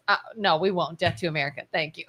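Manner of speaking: low, soft insincere reassurance; deadpan mock delegate. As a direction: mumble the promise low, slogan tucked in. Mock delegate's evasive promise.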